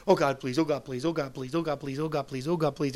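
monotonous looped pleading, run together; vain repetition. Acted. Someone praying on and on for an hour, droning repetition. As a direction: rattle it off as a mindless chant. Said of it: "don't use vain repetitions as the heathens do".

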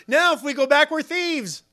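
high-pitched fretting, acted; acted alarm, high and agitated; afraid. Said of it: "they were afraid, saying to one another".

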